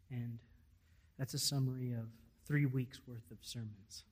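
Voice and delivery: normal talking voice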